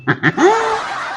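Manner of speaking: squealing burst of laughter; high laugh. Real, not acted. Laughing at own story.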